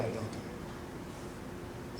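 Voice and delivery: breathy whispered question. Real, not acted. Speaker to the gym of students, a hushed whispered question. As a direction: drop to a bare whisper.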